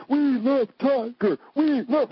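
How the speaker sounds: high, slow, gushing fan voice; mock the press swooning over Tiger. Mocking the fawning press.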